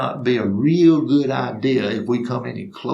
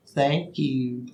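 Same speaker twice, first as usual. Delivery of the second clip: soft, small acted thanks; hushed little reply; meek old lady; 8 dB quieter than usual. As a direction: thank him softly and politely. Lady retreats after alarming answer.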